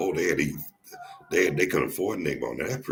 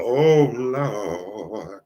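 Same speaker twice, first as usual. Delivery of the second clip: long drawn-out, louder preacher cry; preacher imitation; 6 semitones higher, 6 dB louder than usual. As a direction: wail it like a mock street-corner preacher. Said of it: "how them preachers be doing".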